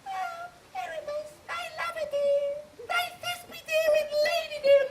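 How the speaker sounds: squeaky high lady voice; tiny monster trying fierce. Acted. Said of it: "I will speak in a monstrous little voice".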